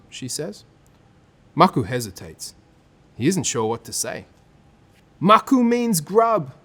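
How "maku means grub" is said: slow, high, louder jeering yell; yelling taunt. Acted. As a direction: yell it to mock. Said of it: "yells the boy".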